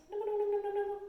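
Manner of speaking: high, loud playful nibble nibble; nibbling giraffe. Shown as a demonstration. Teacher showing kids a giraffe nibbling leaves, high and playful. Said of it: "pretend to be a grateful giraffe".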